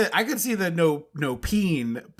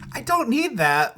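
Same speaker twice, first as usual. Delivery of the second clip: exasperated reaction; 8 semitones higher, slower than usual. Retold or imitated. Own exasperated reaction to nudity.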